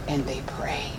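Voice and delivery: a whispered, fading last repeat. Shown as a demonstration. Telling the church how they prayed, whispered. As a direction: let it drop to a breath.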